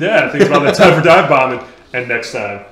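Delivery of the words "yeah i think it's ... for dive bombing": loud, hyped-up announcement. Real, not acted. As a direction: pump everyone up. Hyping up dive bombing.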